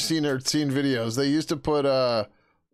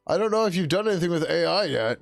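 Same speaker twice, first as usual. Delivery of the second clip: imitating coworker; 6 semitones higher, 4 dB louder, faster than usual. Imitates coworker repeating himself.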